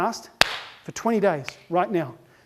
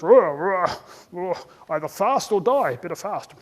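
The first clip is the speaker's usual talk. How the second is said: grumbling human; 3 semitones lower, slower than usual. Acted. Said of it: "Human goes".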